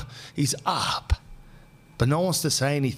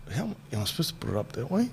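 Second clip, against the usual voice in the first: muttered aside; 2 semitones higher, 6 dB quieter, faster than usual. Real, not acted. Aside, unsure how to phrase.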